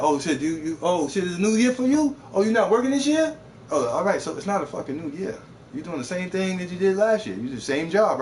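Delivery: sarcastic high questioning; mock disbelief. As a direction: needle them with fake surprise.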